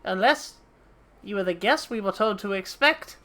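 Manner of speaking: put-on lightly done London dock accent. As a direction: greet the adventurer like a rough dockside recruiter. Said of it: "I'm gonna have to do the Oi in it voice".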